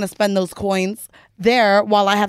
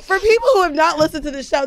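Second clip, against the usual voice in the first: normal talking voice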